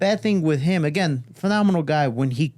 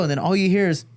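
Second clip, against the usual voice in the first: ordinary delivery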